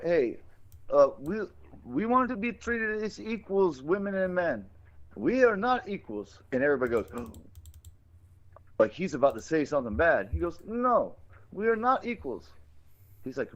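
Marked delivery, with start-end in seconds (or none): 0.0-6.4 high, cartoonish Indian accent; mock Indian accent. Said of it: "do my whole Quickie Mart thing". 7.1-7.9 soft imitated gasp; mock shocked gasp. Mimicking the crowd's shocked reaction. 10.7-12.5 very high cartoon Indian accent; mock Indian accent. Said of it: "do my whole Quickie Mart thing".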